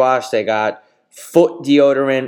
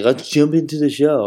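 cheesy host line; 5 semitones higher than usual. Pretend. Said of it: "or something stupid like that".

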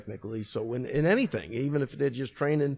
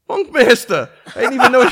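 much higher and louder gushing fan voice; mock starstruck fan; 12 semitones higher, 15 dB louder than usual. Crying fighter suddenly gushing at the coach, excited. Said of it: "he goes".

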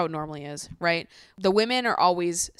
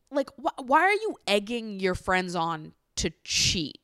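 normal talking voice

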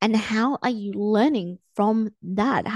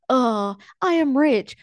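mock-weary, drawn-out affirmation, a bit louder; unconvinced affirmation; 3 semitones higher, 4 dB louder, slower than usual. Coach mimics someone worried about money saying affirmations, drawn-out and unconvinced. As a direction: act out an affirmation you don't believe, slowly.

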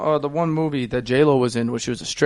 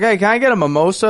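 eager request; 7 semitones higher, 3 dB louder, faster than usual. Retold or imitated. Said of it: "I was like".

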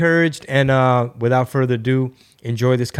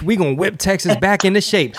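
pumped-up ad slogan, pitched higher; hammed-up political ad line; imitating a campaign ad; 6 semitones higher than usual. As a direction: sell it like a rally cry, a notch up. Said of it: "your political ad".

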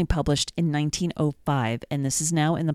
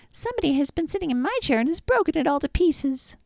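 much higher, squeaky cub voice; little bear wailing over his broken chair, piping high; 11 semitones higher than usual. A narrator's reading. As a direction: pipe it up high like a tiny upset cub. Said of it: "the little bear piped".

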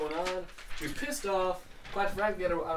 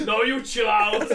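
high, loud, slow laughing bellow; mock Arnold shout; 5 semitones higher, 7 dB louder, slower than usual. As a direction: ham up the yell while laughing. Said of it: "I was like".

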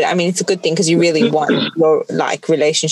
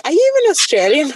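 lightly voiced coworker; 9 semitones higher than usual. Coworkers' quoted question.

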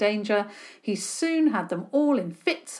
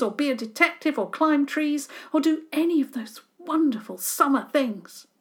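put-on whiny boy complaining; 6 semitones higher, 5 dB louder than usual. A narrator's reading. Boy complaining about his tutor.

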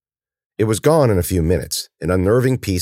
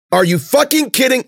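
loud outburst; 4 dB louder than usual. Acted. Outburst while hiding.

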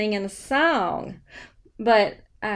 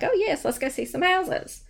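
mildly hammed-up eagerness, higher pitch; eager agreement; 8 semitones higher than usual. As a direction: retell a keen, high-pitched yes. Acting her eager reply to buyer.

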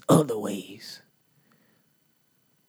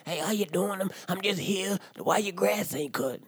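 higher, lightly voiced busybody chairman; mock chairman; 6 semitones higher than usual. HOA chairman asking about the grass in a light mock voice. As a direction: do the nosy chairman. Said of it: "The chairman come on around".

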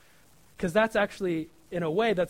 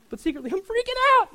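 much higher, louder hammed-up panic; mock panic; 8 dB louder than usual. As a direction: blurt out the hidden freak-out. Confessing hidden panic.

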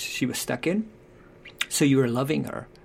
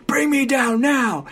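put-on much higher, slow and louder bossy child; bossy demanding daughter; 12 semitones higher, 6 dB louder, slower than usual. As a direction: demand it like a panicked bossy kid. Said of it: "gets really controlling and bossy".

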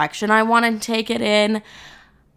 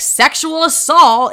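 loud, high, drawn-out mock yell; slow, hammed-up shout; mock scream; 7 semitones higher, 5 dB louder, slower than usual. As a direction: imitate blurting it out for everyone. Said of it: "just, like, screaming it on TikTok".

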